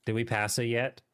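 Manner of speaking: normal talking voice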